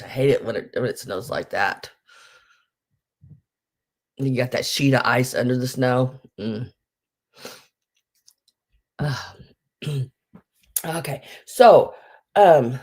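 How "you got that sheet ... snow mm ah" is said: ordinary talk, nothing marked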